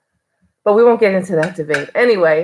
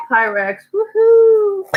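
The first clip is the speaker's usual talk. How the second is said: high, loud woohoo; excited cheer; 4 dB louder, much slower than usual. Real, not acted. Vlogger cheering her big Pyrex haul, excited high woohoo. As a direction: whoop with delight.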